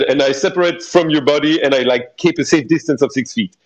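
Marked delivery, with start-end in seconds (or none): none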